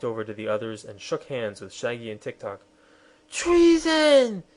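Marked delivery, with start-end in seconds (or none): 3.3-4.4 very high, loud "Treason!"; furious queen shrieking at the deserter. Acted. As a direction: shriek the accusation. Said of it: "shrieked Anne".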